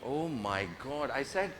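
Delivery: ordinary talk, nothing marked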